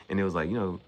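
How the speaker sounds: ordinary talk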